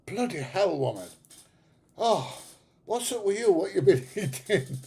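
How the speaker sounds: high, loud mock disgust; hammed-up accusing husband; mock outrage. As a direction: ham up the outrage at her. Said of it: "I'd go".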